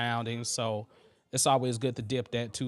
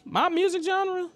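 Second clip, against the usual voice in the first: high, incredulous repeat of the question; surprised echo; 4 dB louder than usual. Real, not acted. Streamer repeating a viewer's question, surprised and high. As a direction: toss the question back, amused and surprised. Echoing a chat question back.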